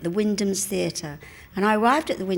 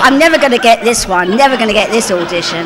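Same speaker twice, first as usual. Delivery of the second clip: loud mock despair; retold outburst, punched out loudly; loud exasperation; 13 dB louder than usual. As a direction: declare it to the whole house. Retold exasperated thought at audition.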